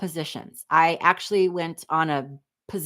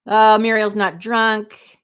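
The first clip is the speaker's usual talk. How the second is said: slow, louder, drawn-out sarcasm; teasing mock denial; 5 semitones higher, 7 dB louder, much slower than usual. Teasing Muriel about her wine, slow and loud. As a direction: tease her with a knowing drawl.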